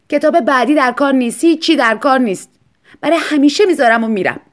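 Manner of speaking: high, loud, final; defiant declaration. Acted. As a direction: slam the door on it. Wife declares she's quitting. (اعلامِ خشمگین و بلند)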